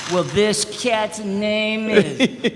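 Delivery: very slow, drawn-out DJ intro; radio DJ voice. Retold or imitated. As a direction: stretch it like a hip DJ. Mocking DJ intro.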